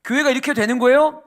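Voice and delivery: loud, high-pitched mock complaint to God; 원망하는 말투 imitated. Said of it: "우리 하나님을 향해서 우리는 원망해요". (높고 큰 소리로 따지듯 원망하는 흉내)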